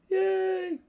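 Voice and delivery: high, hammed-up little cheer; mock cheer. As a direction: squeal it like a kid celebrating. All is right after blocked kick.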